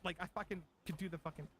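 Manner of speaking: ordinary delivery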